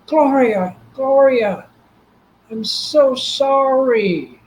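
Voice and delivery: high, pleading cry, drawn out at the end; anguished apology. Retold or imitated. Husband crying out to his lost wife, anguished apology. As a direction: call out to her and beg forgiveness, pitched high.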